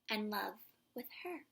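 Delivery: plain reading voice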